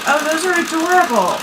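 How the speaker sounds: high, delighted gush. Retold or imitated. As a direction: squeal it like a fan. Friends react to sample blocks.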